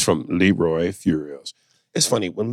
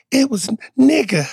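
very high, put-on little voice; mock child's high cry; hammed-up child; 4 dB louder than usual. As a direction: play a distressed kid, pitched way up. Acts child answering daddy in skit.

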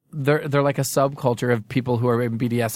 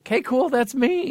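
mimicking; 12 semitones higher than usual. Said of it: "It's psycho".

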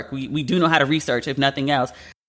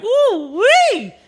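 high, loud drawn-out whoop; big hollered woo-wee, pitched way up; whooping reaction; 5 dB louder than usual. Retold or imitated. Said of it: "I was like".

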